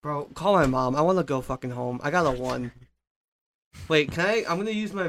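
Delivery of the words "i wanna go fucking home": ordinary delivery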